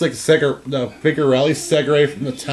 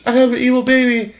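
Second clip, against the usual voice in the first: higher, fast panicked mom voice; mock horror as a cartoon mother; a hammed-up freaked-out Lois; imitating Lois; 9 semitones higher than usual. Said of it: "And then she goes".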